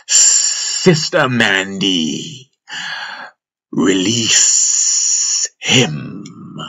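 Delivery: very slow, drawn-out serpent voice; Jandi the snake. Acted. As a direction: stretch each word out like a menacing asp. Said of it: "The master's rendition of Jandi's voice".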